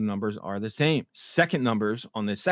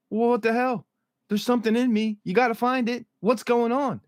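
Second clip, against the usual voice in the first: upset; 10 semitones higher than usual. Retold or imitated. Fatima upset at ultrasound.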